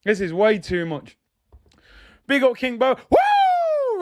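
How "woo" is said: high, drawn-out "woo" in Ric Flair style; piercing celebratory woo. Acted. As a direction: let out a long, high wrestler's whoop. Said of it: "Ric Flair".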